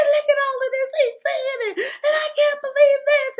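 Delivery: high, loud, half-sobbing wail; crying outburst. Retold or imitated. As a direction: sob it out heartbroken. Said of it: "I said".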